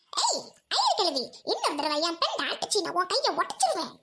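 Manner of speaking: swooping put-on voice; comic, wildly up-and-down menace; excitable friend threatening. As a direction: threaten in exaggerated sing-song.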